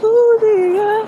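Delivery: high, loud slow start of a scold; exasperated outburst. Real, not acted. Vlogger scolding her coconut oil, loud and high. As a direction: snap at the jar.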